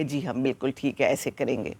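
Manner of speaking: soft, low, courteous reply; polite agreement. Retold or imitated. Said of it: "بڑے اچھے طریقے سے کہا". (شائستگی سے دھیمی آواز میں جواب)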